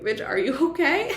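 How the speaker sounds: high-pitched, half-laughing mock concern; imagined amused reaction. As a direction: imitate someone cracking up at a friend's over-the-top crying. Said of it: "you'll probably end up laughing".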